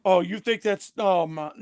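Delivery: ordinary delivery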